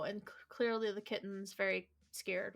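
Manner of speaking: usual speaking voice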